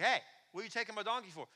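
lightly acted confrontation; mild indignant challenge. Donkey owner challenging someone taking his animal, mildly indignant.

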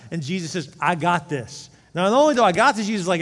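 loud, high, driving preacher voice; fervent preaching. Acted. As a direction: preach it out to the whole church. Preaching at full force.

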